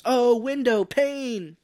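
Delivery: loud, slow, high mock yell; hammed-up dramatic cry; mock scream. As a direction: bellow it out stretched long. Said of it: "yells at a window and screams".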